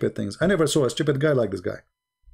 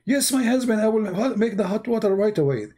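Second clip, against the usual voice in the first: high-pitched put-on wife voice; imitating obedient wife; 9 semitones higher than usual. Wife answering her shouting husband, mock obedient. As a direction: play the wife hurrying to obey. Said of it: "And the woman, she said".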